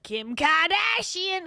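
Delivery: mocking the influencer's fake Boston accent. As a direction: stretch the name in a mock Boston accent. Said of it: "She's the one also that said".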